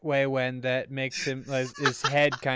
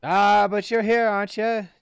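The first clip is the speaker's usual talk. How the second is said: high, loud cheery British voice; raised, breezy British rogue voice; British accent; 6 semitones higher, 6 dB louder than usual. Acted. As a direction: brush it off in chirpy British. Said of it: "It'll be British".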